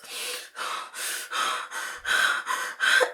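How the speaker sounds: huffing breaths, worked up; voiceless, repeated puffs of breath; imitated heavy breathing. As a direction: act out someone huffing before he charges. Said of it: "And then he just goes".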